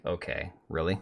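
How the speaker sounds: quiet, deadpan okay really; flat, soft disbelief at the prank; unimpressed. Retold or imitated. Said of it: "I'm like".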